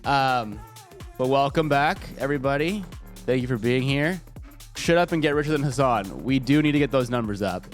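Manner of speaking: usual speaking voice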